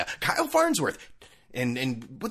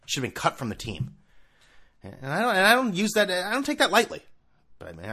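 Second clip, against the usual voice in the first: ordinary talk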